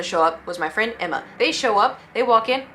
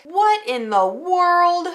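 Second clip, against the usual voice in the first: high, drawn-out stunned cry; mock shocked outcry; 9 semitones higher, 5 dB louder, much slower than usual. Friends spotting a man at the window, shocked outcry. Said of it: "they're like".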